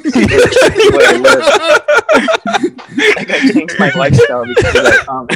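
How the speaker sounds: cracking up mid-sentence; laughing about a mean comment; laughing while talking. Real, not acted. Laughing at own story.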